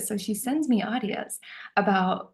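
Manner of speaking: normal talking voice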